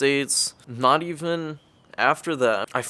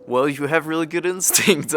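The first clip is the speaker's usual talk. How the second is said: laughing compliment; 4 semitones higher, 5 dB louder than usual. Retold or imitated. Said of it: "legitimately complimenting him".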